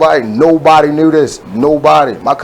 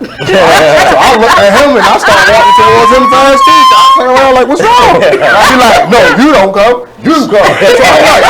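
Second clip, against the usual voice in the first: laughing while telling; 5 semitones higher, 6 dB louder than usual. Real, not acted. Laughing while retelling dad scene.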